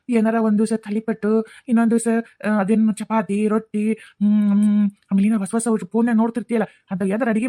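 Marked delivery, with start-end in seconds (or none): none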